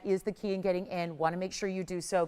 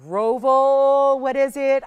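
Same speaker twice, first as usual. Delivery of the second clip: louder and higher, a big ta-da on the name; excited reveal; 6 semitones higher, 12 dB louder, much slower than usual. Real, not acted. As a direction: announce it like a drum roll moment. Dramatic reveal of rare stone.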